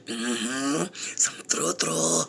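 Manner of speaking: breathy aside whisper, no voice. Acted. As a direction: slip it in as a secret whisper. Whispered aside about brother.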